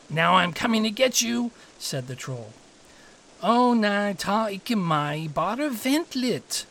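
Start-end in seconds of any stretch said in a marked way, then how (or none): 0.1-1.5 raised, louder storybook threat; threatening troll. A narrator's reading. Troll threatens middle goat. 3.4-6.7 raised, drawn-out plea; pleading middle goat. A narrator's reading.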